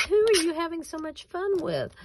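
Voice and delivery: high, light quote of her husband; tossing off the husband's puzzled question, lightly voiced; husband asking. Husband asking who she's laughing with, lightly voiced. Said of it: "My husband comes in and goes".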